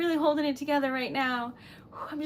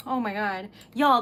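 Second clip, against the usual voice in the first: ordinary delivery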